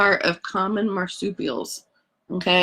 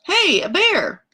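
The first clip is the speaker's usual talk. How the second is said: high, hammed-up cry of discovery; mock explorer shout; 10 semitones higher, 4 dB louder, slower than usual. Captain Cook spotting the koala, hammed-up and high. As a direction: play the startled explorer. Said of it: "Captain Cook said".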